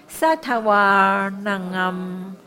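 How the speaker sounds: 萨塔哇 drawn out slowly, 哇 held long; slow, careful 萨塔哇囔啊 with a long 哇; long vowel demo. Shown as a demonstration. Teacher showing class the long 哇, slow and careful. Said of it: "萨塔哇有长音的哦".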